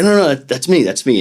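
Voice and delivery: high, loud quick protest; eager correction. Retold or imitated. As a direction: jump in to say it's you. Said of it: "And I'd be like".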